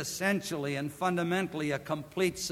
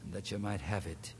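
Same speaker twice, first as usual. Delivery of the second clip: lower, softer, reverent closing prayer; 6 semitones lower, 3 dB quieter than usual. Acted. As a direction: pastor leading the congregation in prayer.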